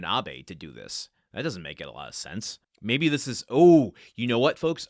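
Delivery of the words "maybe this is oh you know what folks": bursting out, much louder and higher; an idea hitting you mid-sentence; sudden realization. Real, not acted.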